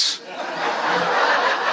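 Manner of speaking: unvoiced breathy laugh. Real, not acted. Laughs at his own joke.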